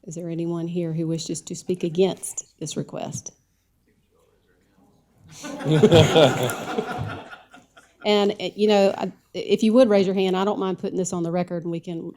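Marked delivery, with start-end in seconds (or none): 5.3-7.6 laugh. Real, not acted. Chair laughs at the empty room.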